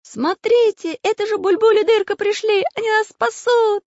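put-on a small boy crying out in joy; hopeful little captive. Captive child sees rescuers.

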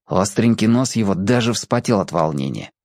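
usual speaking voice

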